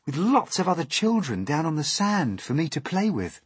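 put-on high, lilting eagerness of a boy; eager. A narrator's reading. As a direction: share a hopeful wish. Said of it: "said James eagerly".

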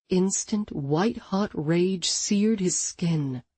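ordinary delivery, nothing marked

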